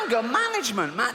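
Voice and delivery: normal talking voice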